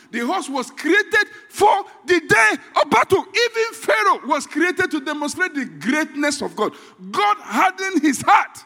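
high, loud, slow proclamation; preaching shout. Real, not acted. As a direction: thunder it out from the pulpit. Sermon climax, shouting.

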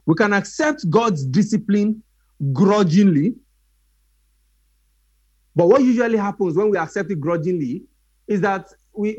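ordinary talk, nothing marked

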